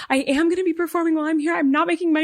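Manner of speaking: high-pitched, hurried mock-hysterical confession; imitating a sobbing over-explainer; tearful panic. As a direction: gush it out high and fast, distraught. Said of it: "I am sobbing, like, hysterically sobbing".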